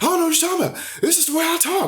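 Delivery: high put-on voice of the woman, defensive; mocking her denial. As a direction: squeak it out like a prissy denial. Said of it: "tried to high road".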